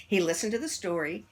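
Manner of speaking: usual speaking voice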